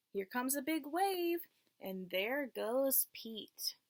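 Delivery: clear, lilting read-aloud voice for a class; teacher reading a picture book to the class; reading to children. Acted. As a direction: read it like storytime for little kids.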